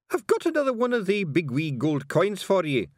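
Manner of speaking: high-pitched broad Scots offer; eager bearded Scotsman; coaxing Scottish stranger. Acted. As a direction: dangle a bribe in a high Scottish voice. Stranger bribes the carter.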